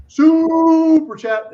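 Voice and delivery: high, slow, drawn-out hype call; big stretched announcer shout; hyped shout. Acted. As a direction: sell it like a game-show host. Hyping the super chat.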